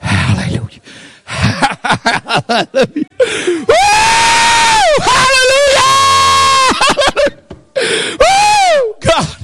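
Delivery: high, drawn-out shouted hallelujahs; preacher overcome with joy, crying out to God; ecstatic praise. Real, not acted. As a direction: break into ecstatic worship, slow and loud.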